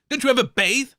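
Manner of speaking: ordinary delivery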